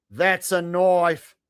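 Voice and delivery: very slow Aussie punchline, "That's a knife" falling at the end; mock Australian accent. Streamer finishing the Crocodile Dundee quote in a mock Australian accent. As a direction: land "That's a knife" as the fake Australian payoff. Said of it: "speaking your native tongue".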